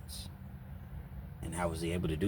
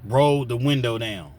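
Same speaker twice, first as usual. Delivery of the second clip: slower, much louder order; firm repeated command; 3 semitones higher, 9 dB louder than usual. Retold or imitated. Husband at the car window, louder insistent command. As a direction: bark it the second time.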